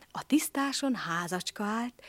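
ordinary delivery

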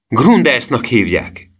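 high, loud, drawn-out exclamation; boy's outcry. A narrator's reading. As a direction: call the name out slowly and hard. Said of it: "kiáltotta Emil".

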